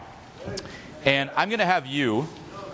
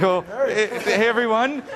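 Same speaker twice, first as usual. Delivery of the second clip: imitating a nervous, higher hello; mock timid greeting; 5 semitones higher than usual. Coach mocking the nervous speaker's greeting, higher teasing voice. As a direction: send up his shaky hello. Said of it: "Look at your fucking body language".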